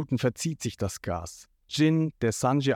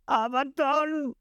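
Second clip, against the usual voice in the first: high, slow whimpering appeal; tearful plea; 11 semitones higher, slower than usual. A narrator's reading. Jin facing his Don, tearfully pleading. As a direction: whine it out as if crying. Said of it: "Jin schaut Krieg verheult an".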